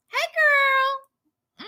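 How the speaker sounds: high, drawn-out hello to a friend; high-pitched greeting. Real, not acted.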